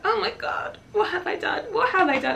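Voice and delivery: high-pitched mock panic; panicked exclamation. Patient retelling the injection sting, panicked.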